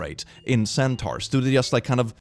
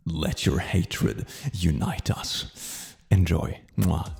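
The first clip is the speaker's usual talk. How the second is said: mock ominous; 7 semitones lower, 3 dB quieter, much slower than usual.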